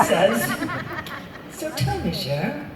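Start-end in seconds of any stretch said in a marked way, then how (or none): 1.5-2.7 soft, teasing host voice lightly imitated; lightly voiced host drawing her in. [name]'s producer-style teasing question to [name], lightly mocked. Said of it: "he didn't do this, but I like it".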